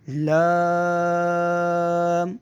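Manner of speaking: لام held very long and steady; elongated لام. Shown as a demonstration. Teacher demonstrating the long لام before the short الف. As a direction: draw out لام slowly. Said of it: "ان دونوں کو نہیں لمبا کرنا".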